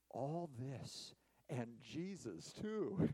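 slow, hushed and grateful; slow, reverent prayer. Retold or imitated. Old Puritan over bread and water, slow reverent prayer. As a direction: savor every word like grace. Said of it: "he said these words".

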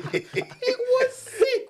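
laughing it out, high and loud; squealing with laughter over the airport. Real, not acted. Excited airport memory, big laugh.

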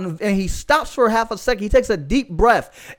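usual speaking voice

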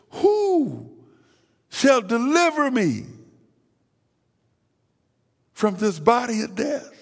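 Paul's cry, starting high on the first word and easing down; Paul's plea. Acted. Paul asks who will deliver him, pleading cry. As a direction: lift "Who" high like a desperate appeal. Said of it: "He says".

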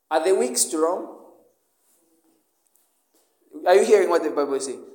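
ordinary talk

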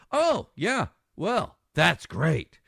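drip with sarcasm, stretch it out; sarcastic. Real, not acted. Host to listeners about trusting the Taliban, sarcastic.

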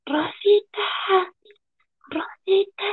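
high sing-song call up the stairs; neighbor calling out. Retold or imitated. Downstairs neighbor yelling up to mom, high calling voice. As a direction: call her name high and drawn out. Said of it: "le grita a mi mamá".

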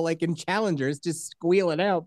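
ordinary talk

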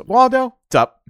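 high, loud mock mother; brightly hammed-up mom; mother greeting. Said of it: "the mother goes".

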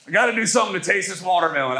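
quick mock watermelon-salter's voice; silly put-on voice. Preacher mocking Southerners who salt watermelon, silly put-on voice. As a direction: put on a daft voice and rush it. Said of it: "that's the voice I use".

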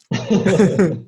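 real, spontaneous chuckle; amused laugh. Real, not acted. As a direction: break into a quick laugh. Laughs after naming the phone.

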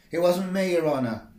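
muffled shout from under a table; muffled call. Acted. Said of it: "I called out in a muffled voice from under the table".

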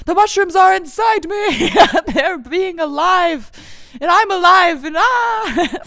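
high, loud, giddy outburst; hammed-up ecstatic yelling; mock-frenzied shout. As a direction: shriek it in over-the-top wonder, laughing between bursts. Acting out mushrooms inside her, giddy.

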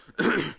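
deliberate fake cough. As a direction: cough on purpose to interrupt. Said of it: "I'll stop fake coughing".

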